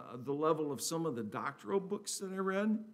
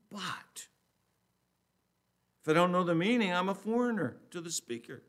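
loud, raised, emphatic Bible reading; scripture reading; 5 semitones higher, 6 dB louder than usual. Acted. Preacher reading scripture to the congregation, raised and emphatic. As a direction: proclaim the verse with deliberate pauses.

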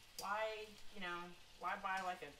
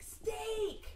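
excited daughter; 14 semitones higher, 5 dB louder than usual. Retold or imitated. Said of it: "And she's like".

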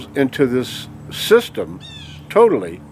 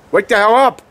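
higher, louder exhortation; furious outburst; 7 semitones higher, 4 dB louder than usual. Real, not acted. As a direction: bark it at the viewers. Ranting at viewers.

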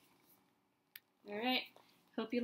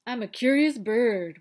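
louder mocking sing-song of the duckling; 2 semitones higher, 13 dB louder than usual. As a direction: parrot him back sarcastically, leaning on curious. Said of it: "Blah, blah, blah".

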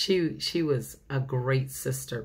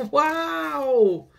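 excited wow; 11 semitones higher, 6 dB louder than usual. Acted. Reacting to story event.

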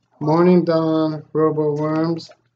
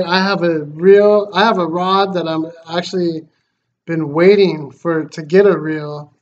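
ordinary talk

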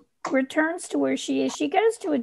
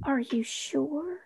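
slow, softer, tentative; hesitant soft question; 3 semitones higher, 7 dB quieter, slower than usual. Acted. Mel asks Arlo about keeping change, hesitant. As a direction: ask it timidly, drawn out.

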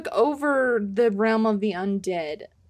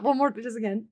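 ordinary talk, nothing marked

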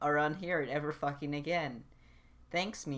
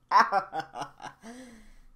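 real laugh; 6 semitones higher, 4 dB louder than usual. Real, not acted. Laughs at own voice lines.

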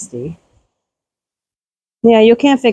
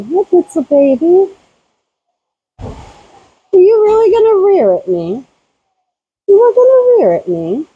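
mock scolding; 9 semitones higher than usual. Real, not acted. Teasing goat Blitzel for rearing, high mock scold.

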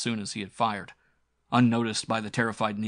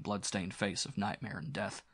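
plain reading voice

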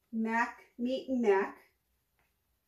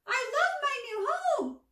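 much higher squawky bird voice, full of glee; gleeful raven voice; 4 dB louder than usual. Acted. Raven delighting in his new sunroom, high squawky glee. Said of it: "Mac shouted with glee".

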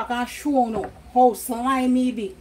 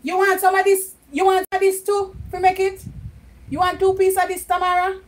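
calling out; 6 semitones higher, 5 dB louder than usual. Real, not acted. Offering Tamara from another room.

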